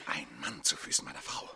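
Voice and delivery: seething aside whisper. Acted. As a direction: whisper it to yourself in fury. Jealous count spies, whispering.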